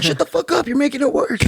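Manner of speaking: in pain, telling servers to shut up; irritated snap. Retold or imitated. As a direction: bark it at the fussing crowd. Snapping at panicking servers.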